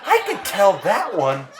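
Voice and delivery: high, laughing voice; giggling Hazel. A narrator's reading. Hazel repeating herself to George, high and giggling. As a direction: giggle it out innocently.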